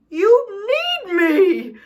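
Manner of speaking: high, loud insistence on you need me; stress on you. Acted. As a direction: hit you and need hard. Pleading point of the letter.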